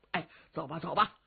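ordinary delivery, nothing marked

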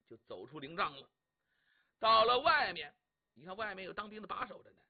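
ordinary delivery, nothing marked

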